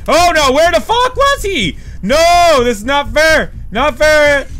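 loud, high protest at being caught; frustrated yelling. Real, not acted. Gamer yelling at the game as he's caught. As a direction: yell it like the game cheated you.

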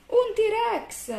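very high, loud cry of alarm; high-pitched storybook shriek; alarmed shout. A narrator's reading. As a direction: scream it as a monster appears. Character spots a T-Rex.

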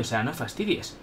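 ordinary talk

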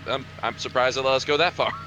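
ordinary talk, nothing marked

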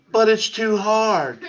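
very high, stretched-out whine; exaggerated high moan of protest; mock whining. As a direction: drag out the hammed-up complaint. Repeats the mocked complaint, more exaggerated.

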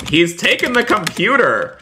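high, astonished outcry; alarmed exclamation. Pretend. Streamer telling viewers the cheese man took the computer, alarmed exclamation. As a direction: cry out as you spot the theft.

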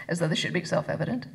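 ordinary delivery, nothing marked

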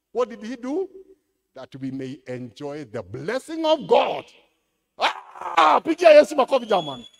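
loud, high, slowed proclamation; full-force preaching. Acted. Pastor firing up the congregation, loud and high. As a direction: drive it home to the crowd.

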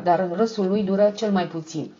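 ordinary delivery

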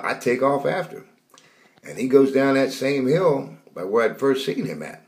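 ordinary talk

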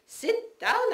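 high, sharp motherly order; mother's voice. Retold or imitated. Son mimicking his stubborn mother, high and sharp. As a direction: bark it like her bossy old mother. Voicing her mother in story.

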